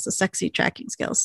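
breathy whispered aside about "sexy"; teasing whisper. Real, not acted. Fan whispering about the tracker's skills, teasing. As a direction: whisper it like a guilty crush.

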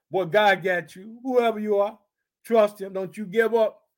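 normal talking voice

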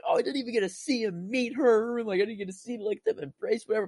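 high-pitched mock lament; retold whiny upset. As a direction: lament it way up high, replaying disappointment. Retelling upset reaction to the ending.

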